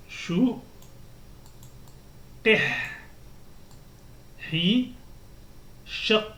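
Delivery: closed syllables said one by one with pauses; sukun drill. Shown as a demonstration. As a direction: model each closed syllable. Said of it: "on doit prendre le soukoun".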